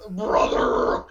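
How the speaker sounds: very deep, drawn-out promo growl; wrestling promo voice. Acted. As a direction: deliver it like a wrestler on the mic. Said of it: "Just start cutting a promo".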